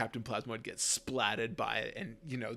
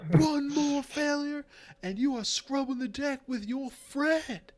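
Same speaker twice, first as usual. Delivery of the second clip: drill sergeant threat; 6 dB louder than usual. Acted. Sergeant warns the cadet of deck scrubbing, menacing. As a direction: threaten punishment duty.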